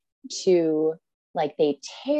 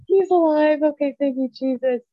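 gasp, then high, loud crying relief; sobbing with relief; 9 semitones higher, 6 dB louder than usual. Retold or imitated. Mother hearing her baby's heartbeat, sobbing with relief. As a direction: break down sobbing with joy. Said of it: "I just start sobbing".

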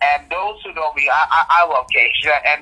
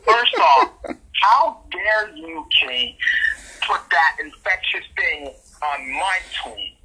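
mock outrage; 2 semitones higher, slower than usual. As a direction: draw out each word like a wronged diva. Theatrical rant at Kate.

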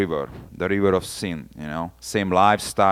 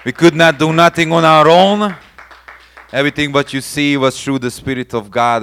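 raised, louder, ringing praise call; loud preaching; 7 semitones higher, 8 dB louder than usual. Real, not acted. Preacher calling out loud to the congregation, rousing praise. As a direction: call the whole room to give glory.

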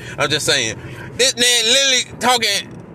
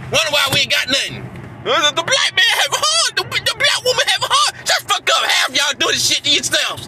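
heated ranting; 6 semitones higher than usual. Real, not acted. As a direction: blast them with real anger. Heated rant at own community.

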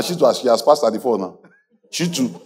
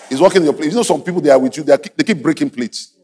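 normal talking voice

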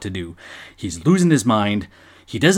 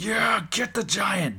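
much higher, slow, egging it on; mad fan cheering; 10 semitones higher, slower than usual. Retold or imitated. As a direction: cheer like a betrayed kid at home. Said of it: "I was now mad".

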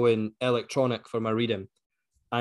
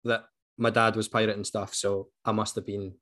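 usual speaking voice

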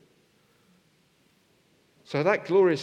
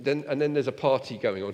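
ordinary talk

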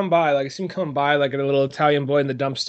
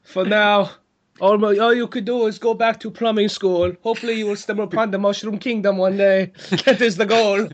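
higher-pitched put-on father voice; papa figure; 4 semitones higher than usual. Papa advises his boy to return to plumbing school, mock solemn. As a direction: pronounce it as mock fatherly counsel.

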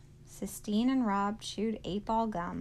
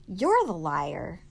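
slow, much louder accusation; dark, cold voice; 10 dB louder, slower than usual. A narrator's reading. Sistine turning on Rob, dark and cold. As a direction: throw it back coldly, slow and hard. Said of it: "said Sistine in a dark, cold voice".

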